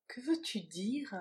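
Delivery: ordinary delivery, nothing marked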